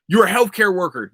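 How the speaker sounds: usual speaking voice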